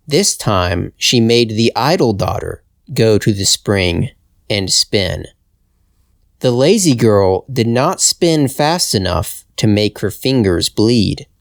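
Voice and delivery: plain reading voice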